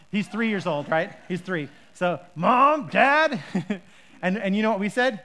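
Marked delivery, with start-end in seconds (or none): 2.3-3.4 high, loud call for both parents; child yelling. Retold or imitated. The three-year-old yells for both parents in the night. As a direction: holler for mom and dad like a toddler. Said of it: "he yells across the house".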